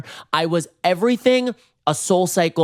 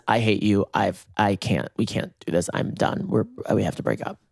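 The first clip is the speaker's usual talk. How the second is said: lower, softer imitation of the boyfriend; 6 semitones lower, 4 dB quieter than usual. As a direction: drop low and quiet for the ex. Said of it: "he says".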